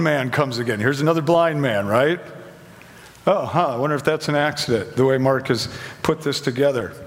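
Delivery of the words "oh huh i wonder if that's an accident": usual speaking voice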